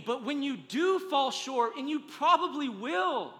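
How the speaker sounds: loud, high, urgent preaching over music; full-force preaching. Acted. Pastor urging the congregation over music, full-force preaching. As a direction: drive it home to the church like a climax.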